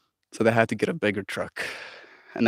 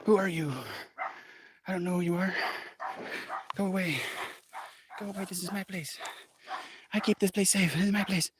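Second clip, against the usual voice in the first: voicing the dog; 6 semitones higher, 7 dB quieter, faster than usual. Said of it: "She's like".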